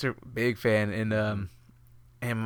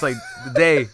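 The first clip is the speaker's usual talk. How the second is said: laughing; 3 dB louder than usual. Real, not acted. Dad's promise dissolves into laughter.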